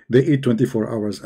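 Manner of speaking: usual speaking voice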